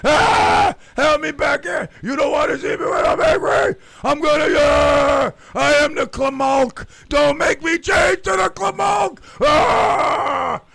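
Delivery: loud, high, panicked shouting from the cop car; cuffed in the back of the police car, shouting; frantic yelling. Retold or imitated. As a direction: scream to be let out. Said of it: "I was like".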